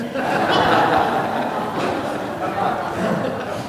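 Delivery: crowd laughing at the punchline; audience laughter. Real, not acted. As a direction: room chuckling. Congregation laughs at joke.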